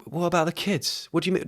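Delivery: high, soft mock-wife voice; imitated worried wife. Wife questioning husband about the kids, imitated worry. Voicing the upset wife in skit.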